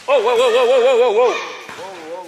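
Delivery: loud repeated whoa to stop it; alarmed whoa shouts. Real, not acted. Hosting a basketball vlog, stopping a thrown ball, loud alarmed shouts. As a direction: call out whoa to break it up.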